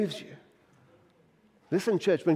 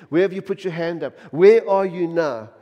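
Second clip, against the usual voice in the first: ordinary delivery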